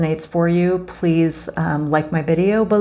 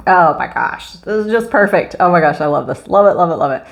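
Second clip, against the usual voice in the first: louder, delighted gushing over the card; thrilled gushing; 3 semitones higher, 4 dB louder than usual. Real, not acted. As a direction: gush with real joy, a bit louder.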